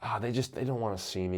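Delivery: humiliated host quietly backing off; deflated retreat. Retold or imitated. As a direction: shrink away after reading mean comments. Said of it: "I'm like".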